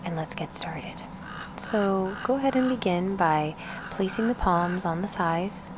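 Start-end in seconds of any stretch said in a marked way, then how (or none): 1.6-5.7 unhurried meditation guide, with long pauses between phrases; guided meditation. Acted. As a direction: ease the listener into stillness, spacing out each instruction.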